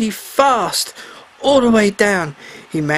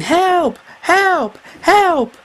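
shouting for help; 11 semitones higher, much slower than usual. A narrator's reading. Mermaid trapped in the boat, shouting for help. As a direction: call out desperately for help. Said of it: "she started shouting".

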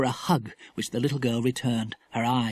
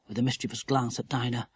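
usual speaking voice

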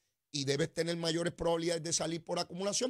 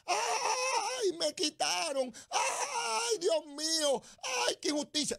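mock crying wail; 10 semitones higher, much slower than usual. Host mocking the whining mayor, high fake sobbing wail. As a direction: wail like a crybaby who lost his money. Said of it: "se iba a llorar por radio y televisión".

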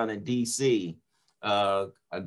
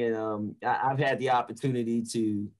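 ordinary delivery

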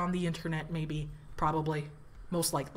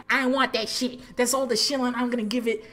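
louder, higher sign-off; 5 semitones higher, 4 dB louder than usual. Real, not acted. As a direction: belt out the outro. Loud sign-off plea.

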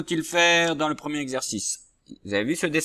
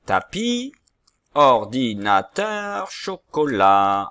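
slow, carefully spoken words; slow naming; 8 dB louder, much slower than usual. Shown as a demonstration. Teacher naming the remaining pictures slowly for pupils. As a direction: spell out each word slowly for the children. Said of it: "C'est-à-dire".

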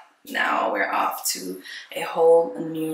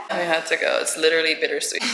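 ordinary talk

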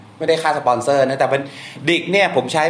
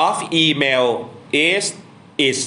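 slow, word by word for the class; slow dictation; much slower than usual. Shown as a demonstration. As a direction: read the answer out slowly so they can write it. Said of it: "ถ้าแปลเป็นภาษาอังกฤษแปลอย่างนี้". (อ่านช้าๆ ทีละคำ)

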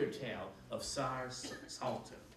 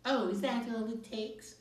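higher, sugary sarcasm; soupy voice; 9 semitones higher, 4 dB louder than usual. A narrator's reading. Witch sarcastic to Kat, in a soupy voice. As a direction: drip fake sweetness. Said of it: "The witch used a soupy voice".

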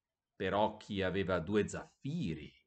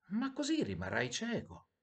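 high-pitched, worried objection; concerned little-bird protest; dismayed protest; 11 semitones higher than usual. A narrator's reading. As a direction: object in alarm, voice lifted high. Swallow protests at the sacrifice.

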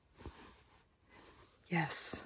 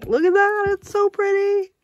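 very high-pitched delighted coo; delighted gushing; 4 dB louder than usual. Real, not acted. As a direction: gush over it in a high squeal. Admiring her own stitching.

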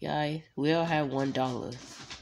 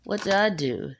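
ordinary talk

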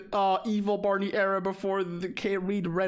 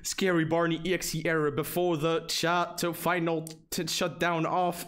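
ordinary talk

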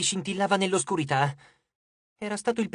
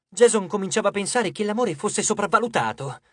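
plain reading voice

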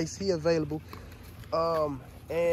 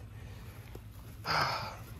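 weary sigh; 3 semitones lower, 7 dB quieter than usual. Real, not acted. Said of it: "like, overwhelmed".

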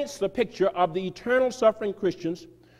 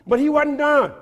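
much higher and louder, with punch; exclaimed; 9 semitones higher, 6 dB louder than usual. Real, not acted. Telling the crowd the preacher kept going, exclaimed high and loud. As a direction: sell the twist to the crowd. Building the reveal to the audience.

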